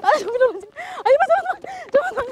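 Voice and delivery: high, frantic pleading; panicked urging. Acted. Ghost hunter begs his friend to hurry away, high and frantic. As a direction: beg your friend to hurry.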